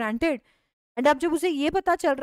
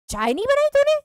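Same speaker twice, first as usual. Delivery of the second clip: much higher and louder, disbelieving; shocked outrage; 12 semitones higher, 5 dB louder than usual. Acted. Husband to wife, outraged at no tea, high shocked yell. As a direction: George can't believe there's no tea.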